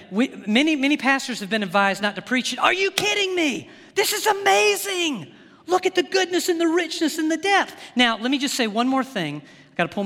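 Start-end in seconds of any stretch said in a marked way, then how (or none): 2.6-7.7 high, laughing burst of delight; can't contain the wonder, pitch soaring; worked up with joy. Real, not acted. Preacher to church, amazed at Romans eleven: overjoyed laughing exclamation. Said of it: "I'm getting worked up as I talk about this".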